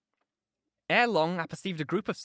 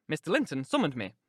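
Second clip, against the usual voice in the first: plain reading voice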